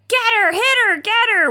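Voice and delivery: high-pitched mock yell; half-hearted screamed urging, pitched high. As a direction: imitate someone screaming orders. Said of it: "Chris is screaming at him".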